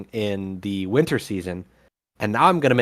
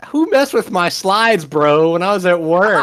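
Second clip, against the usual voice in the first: mock outrage; 6 semitones higher, 6 dB louder than usual. Real, not acted. Slides tampered by panel.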